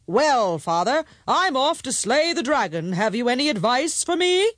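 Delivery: high, hearty, forceful voice; strong middle son. Acted. Strong middle son takes leave of his father, bold.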